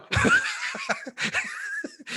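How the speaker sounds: big high laugh in falsetto. Real, not acted. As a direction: burst out laughing, pitch flying up. Laughing at the parenting memory.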